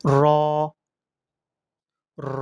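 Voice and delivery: "র" as one full rounded syllable, lengthened; demonstrated Arabic letter. Shown as a demonstration. Teacher models the letter for learners, firm lengthened "র". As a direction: give "র" alone, firm and held. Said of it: "এভাবে উচ্চারণ".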